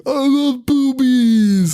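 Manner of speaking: high, drawn-out woo-hoo delivery; stretched, high-pitched party cheer; mock party whoop. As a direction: imitate a rowdy partygoer cheering. Voicing rowdy stripper party guests.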